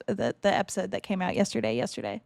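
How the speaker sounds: normal talking voice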